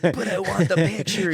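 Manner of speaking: high, loud, slowed-down mock plea; mock pleading protest. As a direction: protest like a kid denied, hammed up. Mock protest to warning buddy.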